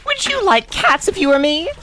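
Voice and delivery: high, outraged mouse squeak; shrill offended protest, very high; indignant squeak. Acted. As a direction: sputter in shocked indignation.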